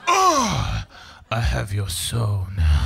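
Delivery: a cat possessing you mid-poo; evil cat voice, slow and low; cat's inner voice. Pretend. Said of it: "like this, like".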